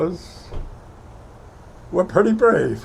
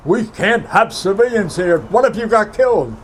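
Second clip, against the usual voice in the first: higher and louder officer's voice, rising to the question; imitating his officer; 6 semitones higher, 5 dB louder than usual. Officer asks what if he got killed, raised mock voice. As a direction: put the officer's alarm into it. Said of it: "And he said to me".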